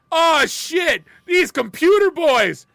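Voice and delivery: hammed-up whoop at the computer boys; excited yell. Retelling excited local kid.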